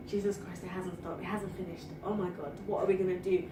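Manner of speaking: low, hushed worried thought; worried inner voice. Retold or imitated. Woman retelling her thoughts while being shouted at, hushed worry. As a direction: drop right down as if thinking it to yourself. Retold alarmed private thought.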